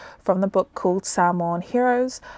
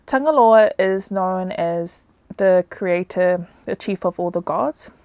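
usual speaking voice

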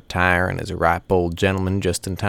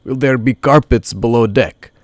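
loud, higher-pitched character voice; eager questioner; 5 semitones higher, 8 dB louder than usual. A narrator's reading. Calgung asking Big Rod about the ship, eager question. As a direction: ask it loudly, pitched up, keen for details.

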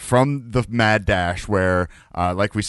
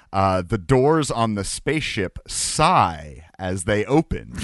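usual speaking voice

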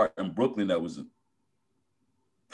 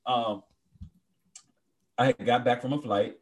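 normal talking voice

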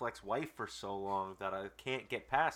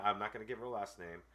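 ordinary delivery

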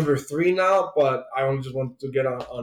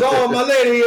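higher, lightly hammed-up gallant; mock gallant musketeer; 7 semitones higher, 4 dB louder than usual. Podcast host imitating the comic musketeer, hammed up a little. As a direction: play a light mock musketeer wooing a lady. Retelling Cavalier's musketeer quips.

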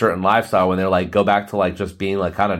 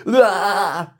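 mock panic yelp; 8 semitones higher than usual. Panic cry after body voice.

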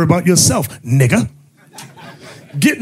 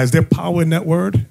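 ordinary delivery

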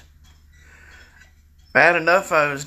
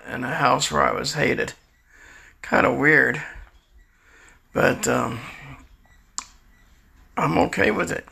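normal talking voice

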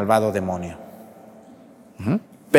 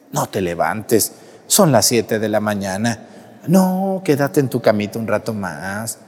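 tempting devil voice; 7 semitones lower than usual. Acted. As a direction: lull them back to bed. Devil tempting lazy person. (voz suave y tentadora del demonio)

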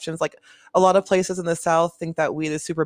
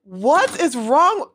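high, louder and drawn out; slow exasperated plea; 9 semitones higher, 5 dB louder, much slower than usual. Real, not acted. Fed up with the phone search, slow and pleading. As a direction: stretch it out in exasperation.

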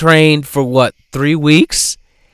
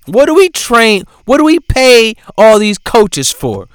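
mother scolding her daughter on the drive home, mock yelling; imitating an angry mom; 7 semitones higher, 3 dB louder than usual. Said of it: "She was yelling at her daughter".

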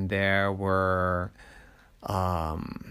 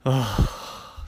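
weary dad's sigh into a word; audible acted sigh; 3 semitones higher than usual. A narrator's reading. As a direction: let out a long breath, then speak. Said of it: "sighed Daddy".

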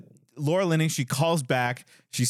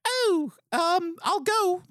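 high falsetto woman's voice; squeaky high lady voice; Laura Linney lady voice; much slower than usual. Retold or imitated. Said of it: "lady voice is not an accent".

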